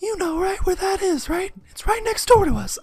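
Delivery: comic Mickey Mouse style mother; falsetto mom voice. Acted. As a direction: keep the high falsetto mother going. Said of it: "I sound like Mickey Mouse".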